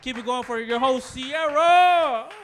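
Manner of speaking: loud emcee call, rising to a shout on the name; emcee hype-up. Real, not acted. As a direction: pump the crowd up for the host. Emcee hyping the crowd.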